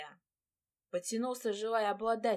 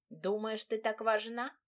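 usual speaking voice

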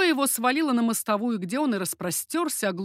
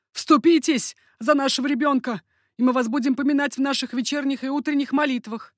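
raised, louder plea; imploring; 5 semitones higher, 5 dB louder than usual. A narrator's reading. Mercandon begging the duchess for their child, imploring. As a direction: implore her with a lifted voice. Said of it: "возмолился Меркандон".

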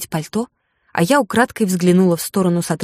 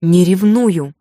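stubborn insistent denial, a bit louder; 4 dB louder, much slower than usual. A narrator's reading. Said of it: "повторила упрямо".

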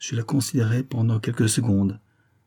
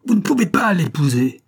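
high, loud, desperate yell; frantic shouted protest; screaming; 9 semitones higher, 5 dB louder than usual. A narrator's reading. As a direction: scream it out to block the way. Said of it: "hurla-t-elle".

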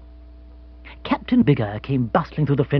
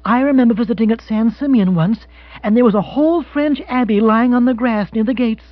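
high-pitched, gushing lady's voice; lifted, animated character voice; enthusiastic American widow; 10 semitones higher, 5 dB louder than usual. Acted. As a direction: play a wealthy woman reminiscing eagerly. Voices Mrs Spottsworth in dialogue.

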